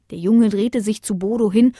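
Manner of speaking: ordinary delivery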